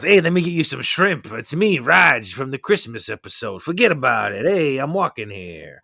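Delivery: a light New York accent, playing dad; dad's New York voice. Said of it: "that's how my dad sounds".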